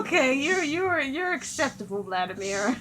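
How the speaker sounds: amused, laughter breaking in; laughing through words. Real, not acted. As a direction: talk while recovering from a real laughing fit. Recovering from a laughing fit.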